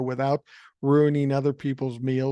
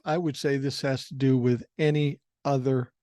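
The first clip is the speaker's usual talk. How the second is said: ordinary talk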